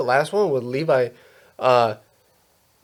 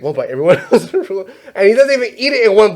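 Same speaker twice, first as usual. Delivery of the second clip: laughing through it; 7 semitones higher, 5 dB louder, faster than usual. Retold or imitated. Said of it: "he has that, like, accent".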